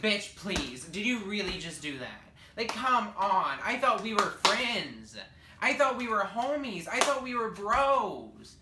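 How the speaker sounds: hammed-up hurt at a friend who told; mock betrayed outrage.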